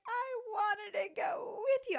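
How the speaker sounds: put-on high, slow crying child; little daughter's voice. Mom quoting her crying daughter, in a high slow child voice. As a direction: wail it like a hurt toddler. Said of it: "and she's like".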